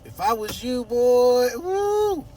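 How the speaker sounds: high squealing woo, teasing Tom; playful fanboy squeal; playful hype. Pretend. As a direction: hype him up like a fan. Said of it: "I'm playing".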